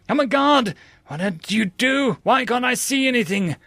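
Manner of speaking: high loud panicked scream; panicked cry. Acted. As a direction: cry out in terror. Eye just pierced, panic.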